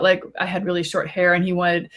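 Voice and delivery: ordinary talk, nothing marked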